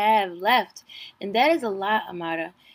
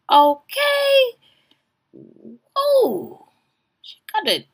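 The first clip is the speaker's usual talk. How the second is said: high, loud delighted okay; excited squeal; 6 dB louder, much slower than usual. Real, not acted. Watching the show, squealing with delight at her. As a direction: gasp with glee at her.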